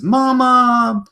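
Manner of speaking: high sustained little voice; baby hippo. Acted. Baby hippo calling to its mama, high and tender. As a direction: call up to mama like a small child. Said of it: "baby hippo looks up ... says".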